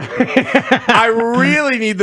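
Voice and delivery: laughing mock plea. Mock gambler pleading, laughing.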